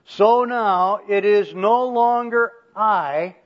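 loud, slow, every word weighted through "no longer I"; emphatic reading. Shown as a demonstration. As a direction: declaim "no longer I" high and deliberately. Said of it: "I'm gonna emphasize some of those emphatics".

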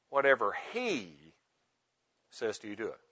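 "he" held and set off by a pause; stress on he. Shown as a demonstration. Preacher showing another angle on the verse, stress on he. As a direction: land on "he", pause, then softly finish. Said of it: "emphasizing one word at a time".

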